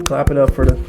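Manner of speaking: usual speaking voice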